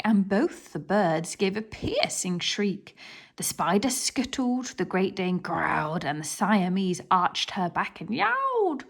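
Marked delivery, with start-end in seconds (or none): none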